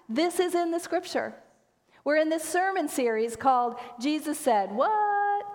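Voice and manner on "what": WHAT? drawn out and swooping up high; incredulous what. Shown as a demonstration. Pastor naming the sermon series, "What" long and incredulous. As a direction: stretch "What" into a rising, disbelieving question. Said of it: "you have to say it like that".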